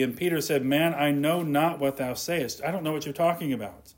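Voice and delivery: usual speaking voice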